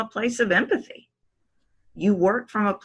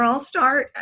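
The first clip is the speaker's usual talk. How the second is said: higher, as if asking permission; raised, permission-seeking pitch; 6 semitones higher than usual. Shown as a demonstration. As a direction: let the pitch climb like you need approval. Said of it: "I'll start getting in a higher pitch".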